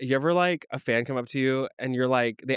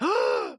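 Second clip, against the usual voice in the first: high, sharp mock gasp; sudden high intake of breath; imitated shocked gasp; 13 semitones higher than usual. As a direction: gasp like startled onlookers. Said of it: "my friends all went".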